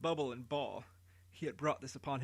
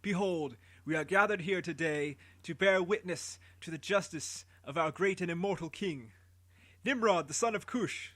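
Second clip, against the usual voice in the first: loud public proclamation; herald proclaiming; 3 semitones higher, 3 dB louder than usual. Acted. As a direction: proclaim to the gathered city. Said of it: "Anuki continued".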